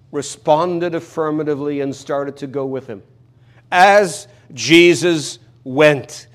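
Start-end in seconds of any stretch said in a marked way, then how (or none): 3.7-6.3 As Jesus went, each word stretched and louder; louder, much slower retake of As Jesus went; very slow emphasis. Shown as a demonstration. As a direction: lean on every word, very slowly. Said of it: "That's astounding and it's wonderful".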